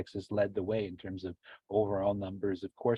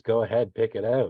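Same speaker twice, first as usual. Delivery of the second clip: Willy Wonka voice; 2 semitones higher, 8 dB louder than usual. Retold or imitated. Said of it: "Especially when Willy Wonka says".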